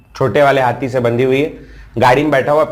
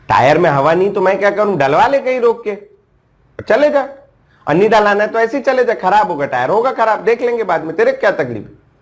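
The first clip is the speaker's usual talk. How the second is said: higher, heated retold scolding; pitched-up mock rant at a friend; exasperated scolding; 8 semitones higher than usual. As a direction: tell him off, fed up with his nonsense. Retelling scolding a pestering friend.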